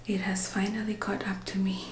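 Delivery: hushed, half-whispered chat; soft near-whisper to the camera. Real, not acted. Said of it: "I'm whispering because Miss Rue".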